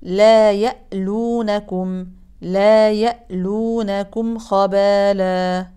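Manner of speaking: لا يألونكم recited slowly, every letter clear; slow recitation demo. Shown as a demonstration. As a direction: chant لا يألونكم slowly and precisely. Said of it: "الآية مية وتمنتاشر". (تلاوة بطيئة واضحة)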